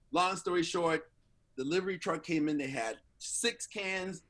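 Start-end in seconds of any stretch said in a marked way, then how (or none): none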